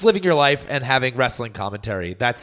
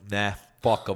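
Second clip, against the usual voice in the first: Undertaker deadpan; 4 semitones lower, 3 dB quieter than usual. Retold or imitated. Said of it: "but he just goes like".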